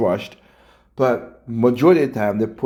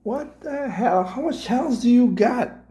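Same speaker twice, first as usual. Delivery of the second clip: incredulous; 14 semitones higher than usual. Real, not acted. Reacts to the surprising channel plug.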